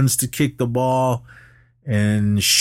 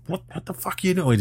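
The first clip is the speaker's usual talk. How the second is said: angry outburst; 8 semitones higher, 3 dB quieter, faster than usual. Retold or imitated. Mullen runs out onto field.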